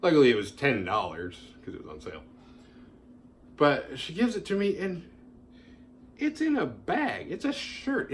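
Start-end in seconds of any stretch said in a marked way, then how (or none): 3.6-8.1 incredulous. Real, not acted. Incredulous retelling of the handover.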